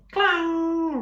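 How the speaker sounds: sharp metallic clang, high and loud. A narrator's reading. Ram's horns hitting the robot, a loud clang. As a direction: ring it out like struck metal.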